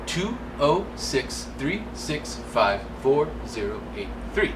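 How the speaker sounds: slow, careful number read; slow deliberate digits. Shown as a demonstration. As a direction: spell out the phone number slowly. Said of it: "Did you get that?".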